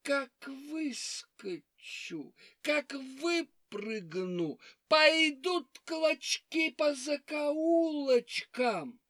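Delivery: slow drawn-out threat; menacing fox. Acted. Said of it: "лиса отвечает".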